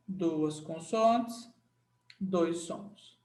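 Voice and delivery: Duas consoantes said very slowly, as if writing it; slow dictation. Shown as a demonstration. Teacher dictating the summary rule, slow. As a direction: drawl it out for note-taking. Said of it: "Você vai botar assim o resumo".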